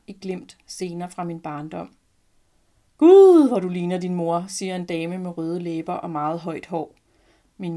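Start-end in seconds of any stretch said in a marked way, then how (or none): 3.0-4.4 put-on an excited lady exclaiming over a child; gushing exclamation. A narrator's reading. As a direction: burst out with delight, then gush.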